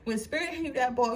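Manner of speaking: normal talking voice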